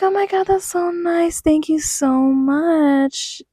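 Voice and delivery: high, slow, sugary thank-you voice; overly sweet high gratitude; sweet polite thanks. Retold or imitated. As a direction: gush politely while hiding panic. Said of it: "I'm like".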